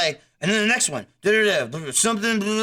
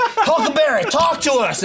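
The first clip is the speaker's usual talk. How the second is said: higher, pleading call with a laugh; half-laughing plea; mock urgent call; 7 semitones higher than usual. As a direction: call to him like a worried buddy. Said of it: "they're like".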